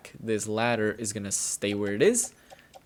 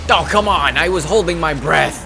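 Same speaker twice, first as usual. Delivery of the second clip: frustrated shout; 6 semitones higher, 10 dB louder than usual. Real, not acted. Frustrated outburst at game.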